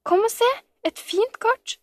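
very high, eager summons; excited calling out. A narrator's reading. Camilla showing Sofie the card, calling eagerly. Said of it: "ropte Camilla".

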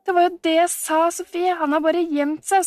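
high, gleeful I-told-you-so; triumphant. A narrator's reading. Camilla proven right to Sofie, triumphant.

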